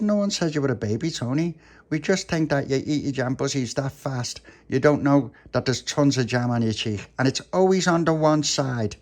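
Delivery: a hint of a young pal reasoning; explaining puppy. Puppy answers Tony.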